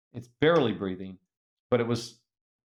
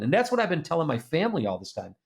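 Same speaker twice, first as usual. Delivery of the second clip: usual speaking voice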